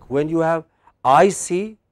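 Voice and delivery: ordinary talk, nothing marked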